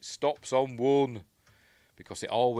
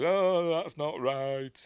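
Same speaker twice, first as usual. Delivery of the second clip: character quote; 8 semitones higher, 5 dB louder, slower than usual. Retold or imitated. Voicing a character's line.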